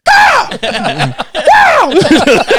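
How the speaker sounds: high laughing shout; retold whoop, loud and high with laughter; laughing yell. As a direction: belt out a wild laughing chant-yell. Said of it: "I just start going".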